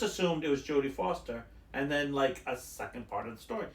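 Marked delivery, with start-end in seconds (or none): none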